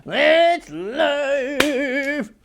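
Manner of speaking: "That's life" belted out loud and stretched, sung like a lounge singer's big finish. Shown as a demonstration. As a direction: croon "That's life" like a showstopper. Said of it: "That's what people say".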